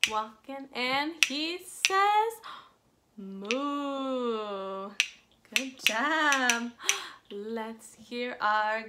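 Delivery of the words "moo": cow moo. Shown as a demonstration. Teacher voicing the cow.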